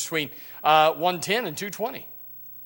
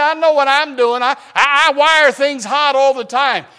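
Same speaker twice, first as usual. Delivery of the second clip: cocky coworker; 9 semitones higher, 11 dB louder than usual. Retold or imitated. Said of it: "he said".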